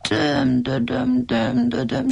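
slow, whimpering moan of pain; painful wailing. Acted. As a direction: whimper the name slowly in pain. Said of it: "síránkozott fájdalmasan". (lassú, fájdalmas nyöszörgés)

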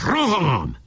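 drawn-out, high battle call; high charge cry. A narrator's reading. Boka calls into the hut to attack, high cry. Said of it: "és bekiáltott".

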